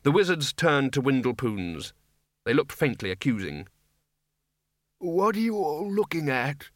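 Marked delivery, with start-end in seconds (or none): 5.0-6.7 put-on high-pitched old wizard voice; querulous old man. A narrator's reading. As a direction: pipe up as an indignant old codger. Old Windle challenges staring wizards.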